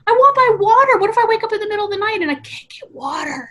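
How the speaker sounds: put-on high, childlike worried voice; mock scared kid. Recalling being a scared child at bedtime, high and worried. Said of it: "I was like".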